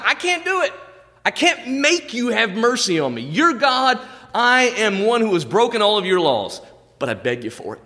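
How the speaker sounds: high, pleading sinner begging God; pleading beggar. Acted. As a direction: beg for mercy in a high voice. Tax collector begs God.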